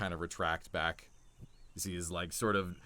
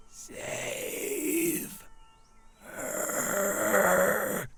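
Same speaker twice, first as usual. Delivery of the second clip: raspy dying whisper; 11 semitones higher, 4 dB louder than usual. Acted. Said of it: "very softly and raspy coming from its voice".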